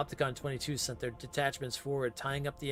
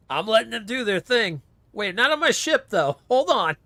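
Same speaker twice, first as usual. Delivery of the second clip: raised, louder voice; loud protest; 6 semitones higher, 9 dB louder than usual. Real, not acted. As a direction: shout them down. Captain stops duel on ship.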